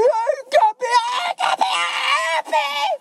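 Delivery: put-on high squealing toddler yell for the cup; imitating a fussing baby. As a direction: screech like a baby whose cup is taken. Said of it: "they ain't done with their cuppy".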